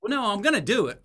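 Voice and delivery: high, loud insistence; mock protest. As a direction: protest back, imitating a stubborn complainer. Complainer protests in acted argument.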